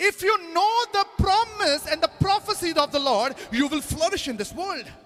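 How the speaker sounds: high, ringing, drawn-out proclamation; preaching declaration. Real, not acted. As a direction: proclaim it high and slowly to the congregation. (ਉੱਚੀ ਸੁਰ ਵਿੱਚ ਪ੍ਰਚਾਰ)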